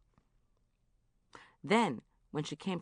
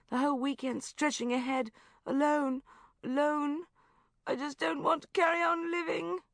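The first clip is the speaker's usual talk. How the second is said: despairing lament; 10 semitones higher than usual. Acted. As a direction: wail it as the end of the world. Friend in despair on phone.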